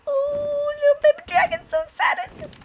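squeaky, thrilled gushing; excited cooing. Real, not acted. Cooing over the egg.

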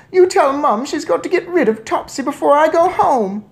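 very high, loud squeaky order; shouting mouse boy. A narrator's reading. Mouse boy orders father about cat, squeaky shout. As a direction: squeal the demand insistently. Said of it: "Bruno shouted from inside the handbag".

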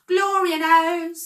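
high, loud exclamation; screech with a yawn. A narrator's reading. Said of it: "screeched the first with a yawn".

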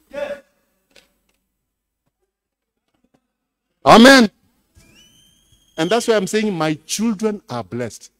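very loud preaching voice; full-force preaching. Acted. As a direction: shout the message to the hall.

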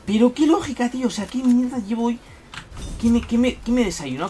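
exasperated rant. Real, not acted. After missing an easy shot, high exasperated outburst. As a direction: vent loudly at your own bad aim.

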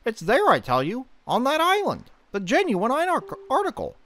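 put-on a chatty old fish giving advice; Fishman voice. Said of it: "the Fishman voice".

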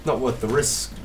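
usual speaking voice

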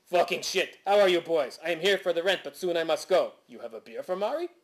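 hearty exaggerated Quebecois accent; Maury Villepen. Landlord drops by for rent and beer, exaggerated Quebecois accent. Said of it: "as in".

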